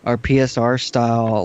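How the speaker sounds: normal talking voice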